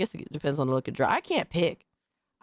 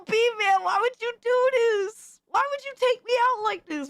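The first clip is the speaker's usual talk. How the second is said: mock betrayed whine. Streamer pleads with the artist, squeaky betrayed whine. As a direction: wail it like a wronged cartoon victim.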